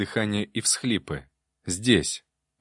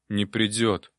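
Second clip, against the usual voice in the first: usual speaking voice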